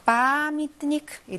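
빠미트니크 with a long, stressed 빠; stress on first A. Shown as a demonstration. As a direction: stretch and stress the 빠 of 빠미트니크, slowly. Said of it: "강세가 아에 있습니다".

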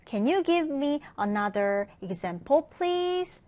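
very slow, careful English question; slow model English sentence. Shown as a demonstration. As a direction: read the example sentence slowly for learners. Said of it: "reading model sentence". (아주 천천히 영어 예문 읽기)

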